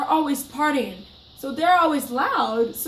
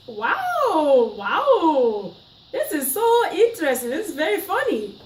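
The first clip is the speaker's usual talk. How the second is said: stunned disbelief; 5 semitones higher, 4 dB louder, slower than usual. Retold or imitated. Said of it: "I was just like".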